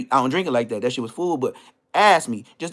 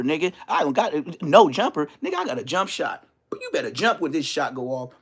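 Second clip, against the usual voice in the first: ordinary delivery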